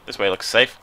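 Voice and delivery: ordinary talk, nothing marked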